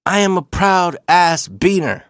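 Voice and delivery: acting out a proud dad, not yet yelling; proud declaration. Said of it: "he started yelling it".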